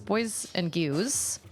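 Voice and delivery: put-on "gews" said slowly with the R dropped, like a toddler; "Boys and gews" drawn out, "gews" without any R sound; says w for r. Shown as a demonstration. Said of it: "I couldn't say my R's when I was really little".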